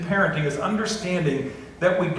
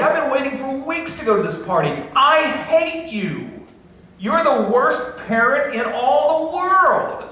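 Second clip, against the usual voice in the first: put-on shrill, much higher, slow child's wail of hate; angry kid; 10 semitones higher, 4 dB louder, slower than usual. Child yelling at a parent over a party, high and slow. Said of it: "every parent's been told by his kids that they're hated".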